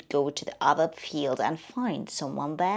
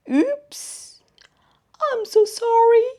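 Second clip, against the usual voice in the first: high, loud flustered "oops"; startled apology; 6 dB louder than usual. Acted. Worm apologizes to butterfly.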